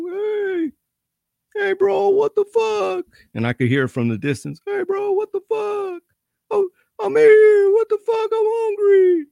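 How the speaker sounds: holler, half crying, from a locked cell; distant hollering. Retold or imitated. Forgotten inmate yelling from the shower cell, desperate crying holler. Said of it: "and he's hollering".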